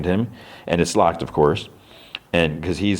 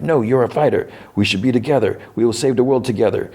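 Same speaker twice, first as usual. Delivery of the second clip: higher, urgent begging; pleading father; 5 semitones higher than usual. Retold or imitated. Alexei urging Yelena to save the world together, high pleading. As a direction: beg her to come with you.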